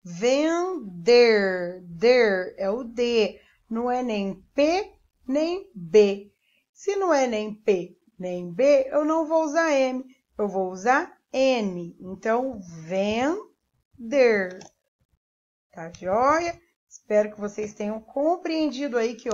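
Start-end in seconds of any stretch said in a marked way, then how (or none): 0.0-2.5 "Vender" slowly, then a strong "der" alone; sounding out syllables. Shown as a demonstration. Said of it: "Preste atenção na sílaba que vem". 12.9-14.6 "vender" split, held "ven", then "der"; sounding out syllables. Shown as a demonstration. Dictating while writing the word.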